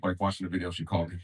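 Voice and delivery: usual speaking voice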